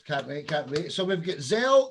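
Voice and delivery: usual speaking voice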